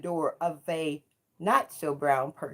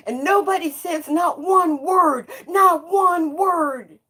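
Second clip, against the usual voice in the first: higher and louder, not one word slowed right down; outraged cry; 8 semitones higher, 4 dB louder than usual. Real, not acted. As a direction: drive every word of not one word home. Outraged preaching at the silence.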